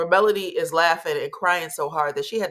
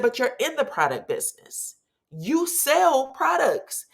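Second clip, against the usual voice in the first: higher, slow, mock-incredulous Melody; incredulous retort; 8 semitones higher than usual. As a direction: drag it out up high like you can't believe him.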